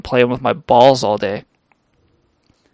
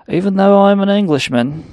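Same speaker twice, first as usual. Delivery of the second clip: put-on a light posh English accent, higher and louder; mock English accent; 7 semitones higher, 4 dB louder than usual. Comic to the audience, a hint of an English accent. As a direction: play the Englishman for the crowd. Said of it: "I just do this accent for you".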